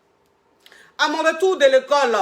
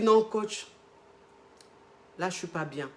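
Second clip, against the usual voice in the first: much softer, lower and lifeless; heartbroken voice; 5 semitones lower, 8 dB quieter than usual. Retold or imitated. Client telling her coach she is not well, quietly heartbroken. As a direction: let the words sink away in heartache. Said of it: "une voix de quelqu'un qui a le goumen".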